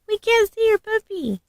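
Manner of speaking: put-on high, sing-song talk to a baby. Talking to the baby doll about its hidden puppy, high baby talk. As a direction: coo it to the little doll, high up.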